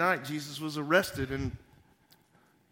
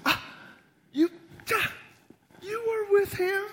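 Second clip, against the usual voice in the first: put-on teenage girl; 3 dB louder than usual. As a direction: squeak it out like an accusing young girl. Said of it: "this teenage girl comes up to Peter and says".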